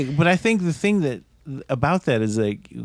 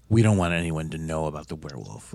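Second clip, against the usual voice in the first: low, hushed villager voice; secretive townsfolk; 10 semitones lower, 6 dB quieter than usual. Acted. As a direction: keep it low and quiet, guarding the town secret. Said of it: "their whole, like".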